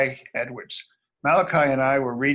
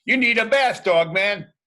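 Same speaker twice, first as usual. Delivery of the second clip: raised, bossy voice; bossy call; 5 semitones higher, 5 dB louder than usual. Acted. Kid voicing a cop, bossy call to Dogman. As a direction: tell him off loudly. Cops telling dog to bathe.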